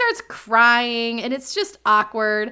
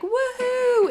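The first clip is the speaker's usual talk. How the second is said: high-pitched whoop; playful high mock yell, no added volume; childlike yell; 13 semitones higher than usual. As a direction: imitate a gleeful child whooping. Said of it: "skidding on the ice and yelling".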